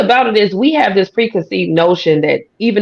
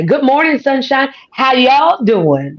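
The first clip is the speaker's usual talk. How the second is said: high-pitched, folksy country twang greeting; 6 semitones higher, slower than usual. Shown as a demonstration. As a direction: sing out a Southern country hello, high and drawn out. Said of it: "that country side of me".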